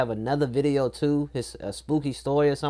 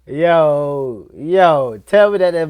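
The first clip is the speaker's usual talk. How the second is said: loud, stammering disbelief; real startled reaction; 7 dB louder, much slower than usual. Real, not acted. Viewer cutting into the baby story, loud startled disbelief. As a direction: burst in loudly, stumbling over the words.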